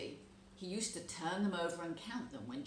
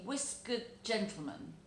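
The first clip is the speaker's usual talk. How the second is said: plain reading voice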